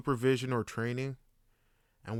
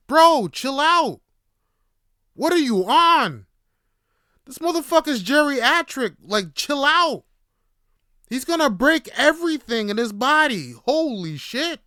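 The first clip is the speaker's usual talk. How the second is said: shocked shouting; 11 semitones higher, 10 dB louder than usual. Retold or imitated. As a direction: shout him down in disbelief. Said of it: "I'm like".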